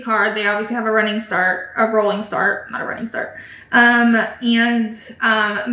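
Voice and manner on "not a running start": whispered correction under the breath; whispered self-correction. Real, not acted. Vlogger correcting her own word, whispered. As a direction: fix the slip in a murmur.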